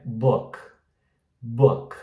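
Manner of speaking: subtle short vowel in "book"; correct pronunciation. Shown as a demonstration. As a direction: say "book" halfway between oo and oh. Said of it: "É book". ("book" curto e sutil)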